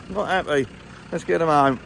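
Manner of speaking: usual speaking voice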